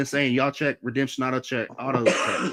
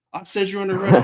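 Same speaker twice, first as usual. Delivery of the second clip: raised, louder retold command; insistent arrest order; 6 semitones higher, 7 dB louder, faster than usual. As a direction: act out an officer insisting. Acting out repeated arrest order.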